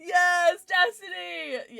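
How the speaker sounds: loud, high, drawn-out cheer; elated high yell; celebratory shout. Real, not acted. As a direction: cheer the winner's name slowly. Celebrates a viewer's correct guess.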